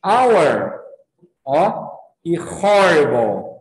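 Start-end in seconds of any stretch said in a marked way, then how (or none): 0.0-0.8 "Our" starting straight on the vowel; silent H. Shown as a demonstration. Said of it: "olha a diferença de pronúncia". 2.4-3.6 "horrible" drawn out, with a clear H; sounded H. Shown as a demonstration. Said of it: "olha a diferença de pronúncia".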